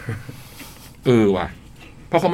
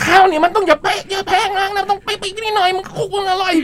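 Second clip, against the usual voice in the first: much higher put-on voice of the teacher; imitating the teacher. Host mimics teacher directing the cooking, high and squeaky. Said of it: "ทำไมทำเสียงเหมือนนะ".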